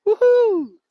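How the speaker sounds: high, loud excited whoop; big happy woohoo; cheer. Real, not acted. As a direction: cheer her arrival. Welcoming Queen Letitia to the stream.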